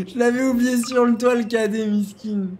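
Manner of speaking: high, squeezed voice through laughter; real laughing. Real, not acted. Moderator realizes he forgot a player on the roof, laughing hard. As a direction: crack up while getting the words out.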